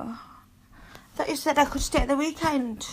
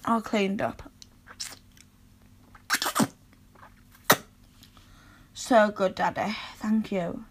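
soft afterglow; 6 semitones lower, 5 dB quieter than usual. Acted. Lover to partner afterward, lower and softer. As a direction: lower and softer, intimate murmur.

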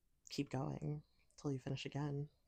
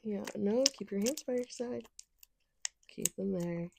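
louder, insistent correction; firm command; 4 semitones higher, 7 dB louder than usual. Acted. Telling the partner to keep still, firm command. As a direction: raise the voice to give an order.

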